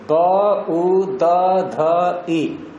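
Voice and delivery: letter-by-letter classroom spelling. Shown as a demonstration. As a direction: sound by sound, with gaps. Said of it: "कौन से number पे आया".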